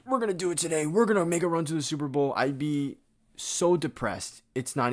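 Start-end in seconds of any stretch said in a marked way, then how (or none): 0.1-2.3 higher and fast, faking fan excitement; sarcastic imitation of an overexcited Vikings fan; mock optimistic hype. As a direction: play the hyped-up fan who's sure of a Super Bowl run. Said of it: "be like".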